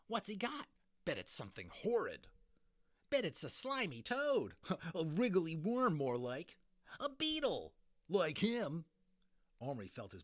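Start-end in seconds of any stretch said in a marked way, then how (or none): 0.1-8.9 put-on high, loud jeering children's voices; taunting kids. A narrator's reading. Crowd of children taunting the boys, high and jeering. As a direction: jeer and cackle like a playground mob.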